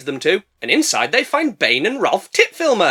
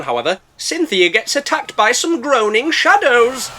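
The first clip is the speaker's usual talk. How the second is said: ordinary talk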